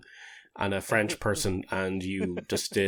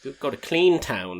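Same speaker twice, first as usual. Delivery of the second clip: bright, louder and higher on the name; playful suggestion; 6 semitones higher, 5 dB louder than usual. Real, not acted. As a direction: pitch Clean Town like a great idea. Joking suggestion to go to Clean Town.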